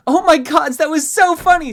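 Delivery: squealing, very high and loud twin; maniacal glee. A narrator's reading. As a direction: shriek with delight at the prank. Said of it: "Pip and Squeak laughing maniacally".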